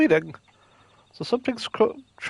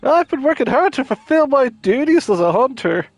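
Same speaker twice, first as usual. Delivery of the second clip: excited high NPC voice; 8 semitones higher than usual. Acted.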